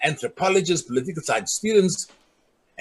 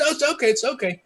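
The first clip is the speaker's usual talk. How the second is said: high-pitched retold reassurance; placating; 10 semitones higher than usual. Villager on the phone to the angry caller, placating.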